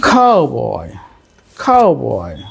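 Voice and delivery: কাউবয় drawn out, gliding downward; English word tune. Shown as a demonstration. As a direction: stretch both syllables of কাউবয় and let the pitch fall away. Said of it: "এই যে একটা word".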